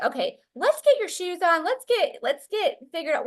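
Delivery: high-pitched, hurried-mother retelling; rally a child to get moving; urgent coaxing. Retold or imitated. Mother getting son off to urgent care, urgently coaxing.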